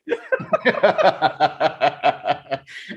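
long hearty laugh. Real, not acted. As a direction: laugh it out at your own joke. Laughs at time zone joke.

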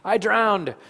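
high, loud mock scream; retold child's scream. Preacher voicing his son pulled from underwater, mock scream. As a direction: imitate a panicked child surfacing from the water. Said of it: "he would scream".

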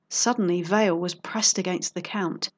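ordinary delivery, nothing marked

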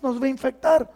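high, breathless alarm; alarmed warning. Retold or imitated. Disciples warning Jesus off the leper in alarm. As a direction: warn them off in a panic. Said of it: "y dice".

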